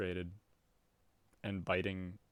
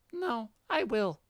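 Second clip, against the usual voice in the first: put-on much higher, childish defiant cat girl; 13 semitones higher, 5 dB louder than usual. Cat girl refusing the troll's order, high and childish. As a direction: squeak back like a defiant kitten.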